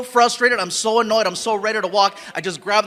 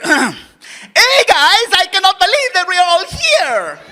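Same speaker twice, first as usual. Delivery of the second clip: higher, louder, exaggerated Mexican accent; heavy Mexican accent; 8 semitones higher, 6 dB louder, slower than usual. Shown as a demonstration. Actor reading take three with a heavy Mexican accent. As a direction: double the spice, full Mexican accent. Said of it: "take three".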